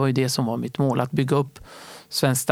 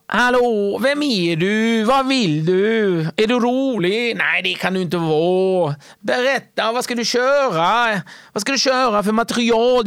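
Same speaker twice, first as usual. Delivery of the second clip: Wahlbeck impression; 7 semitones higher than usual. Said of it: "Och Wahlbeck bara". (hög, högljudd och långsam Wahlbeck-imitation, dryg och överlägsen)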